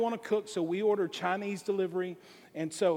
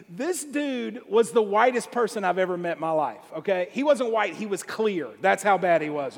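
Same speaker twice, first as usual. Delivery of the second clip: usual speaking voice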